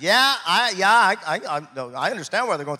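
sudden loud defensive yeah; mock loud protest. Friend imitated brushing off a plea, loud and defensive.

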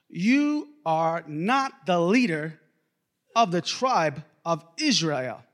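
slow, emphatic prophetic rebuke; stern pronouncement. A narrator's reading. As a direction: deliver the charge weightily, word by word. Acting out Samuel's stern rebuke.

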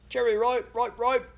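high, slow Cockney vendor's call; a Cockney hawker crying wares, high and slow; Cockney street cry. A narrator's reading. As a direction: sing out the lavender like a Cockney seller. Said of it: "street cries that resounded in Cockney accents".

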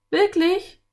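high, loud, astonished question; surprised girl. A narrator's reading. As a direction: react as an amazed girl. Girl reacts to cowboy's news.

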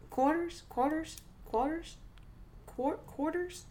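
"quarters" tried with a careful rising question; sounding out "quarters" slowly; practicing quarters. Shown as a demonstration. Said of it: "I'm taking voice and diction right now".

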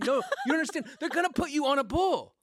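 high, laughing mock plea; a friend laughing as he begs another not to ride a bull.

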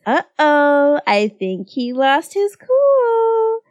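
high, slow singsong taunt; gleeful singsong uh-oh; mock concern. A narrator's reading. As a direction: tease in a rising, drawn-out lilt. Twins taunting the angry senior.

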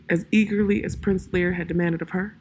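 usual speaking voice

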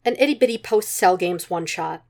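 plain reading voice

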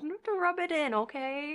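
mock offended.

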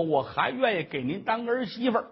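usual speaking voice

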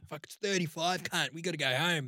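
put-on broad Queensland Aussie accent; put-on Queensland local, complaining. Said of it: "your [name]'s Queensland voice".

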